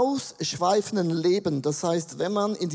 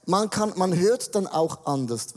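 ordinary talk, nothing marked

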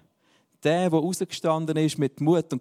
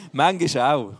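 laughing through the words; chuckling as he says it; amused laugh mid-sentence; 3 dB louder, slower than usual. Real, not acted. Laughs at own joke.

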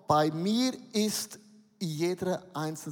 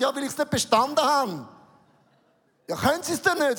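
higher, louder indignant retort; acted exchange; 6 semitones higher, 5 dB louder than usual. Pastor replaying the examiner interview, raised and indignant. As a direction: act out both sides of the interview.

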